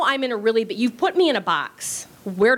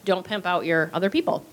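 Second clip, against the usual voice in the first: usual speaking voice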